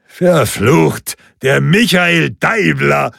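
pleased surprise, drawn out; delighted exclamation. A narrator's reading. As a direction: call out on spotting an old friend. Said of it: "rief Kuisel erfreut aus".

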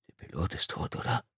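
hushed, fearful whisper; barely voiced, soft question. A narrator's reading. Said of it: "flüsterte Tom".

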